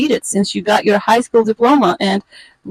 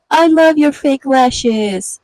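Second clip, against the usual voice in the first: slow, higher and louder with sugary fake praise; fake sweet compliment; 5 semitones higher, 4 dB louder, much slower than usual. One woman to another, sugary fake praise. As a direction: stretch it out like a false compliment. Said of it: "out of her mouth, you hear".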